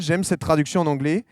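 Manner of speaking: ordinary talk, nothing marked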